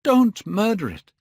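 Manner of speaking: loud, very high, desperate cry. A narrator's reading. Boy saving his portrait, crying out. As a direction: shriek it out in desperation. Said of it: "he cried".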